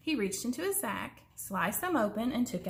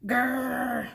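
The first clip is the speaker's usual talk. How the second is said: bear growl; 2 semitones higher, 3 dB louder than usual. A narrator's reading.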